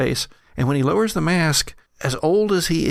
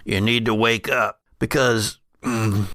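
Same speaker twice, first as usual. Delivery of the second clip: put-on old brother, urgent and regretful; 3 semitones lower than usual. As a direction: lower old man's voice, halting.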